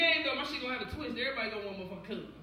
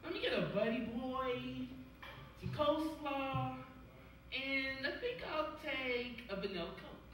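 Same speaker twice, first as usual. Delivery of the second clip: ordering at counter; 5 dB quieter, slower than usual. Retold or imitated. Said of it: "y'all order some shit".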